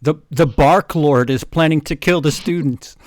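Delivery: yelling. Acted. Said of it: "Yelled Cornelia Fudge".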